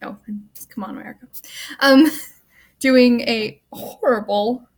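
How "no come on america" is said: a breathy, voiceless aside; whispered let-down aside; exasperated whisper. Real, not acted. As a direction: whisper it to yourself in disappointment. Aside after learning actor's nationality.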